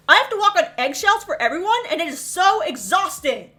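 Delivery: high, loud, exasperated rant; angry tirade. Retold or imitated. Daughter unloading on her mother in a retold quarrel, exasperated. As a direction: pile up grievances with rising exasperation.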